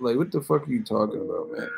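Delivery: ordinary delivery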